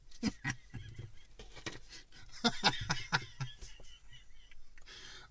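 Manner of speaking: high, soft, breathless laughing fit; helpless silent laughter; long wheezing laugh. Real, not acted. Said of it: "I've got to stop".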